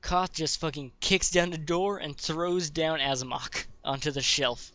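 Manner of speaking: usual speaking voice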